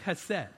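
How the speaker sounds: chesed with a throaty h at the start; Hebrew chesed. Shown as a demonstration. Pastor teaching the church the Hebrew word, throaty ch. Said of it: "that Hebrew word for steadfast love is".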